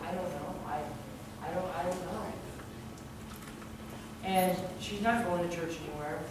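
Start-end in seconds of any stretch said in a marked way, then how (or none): 0.1-2.2 very soft, faltering reply; subdued halting answer. Retold or imitated. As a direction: quietly stumble over having no answer. Answering a widow at funeral.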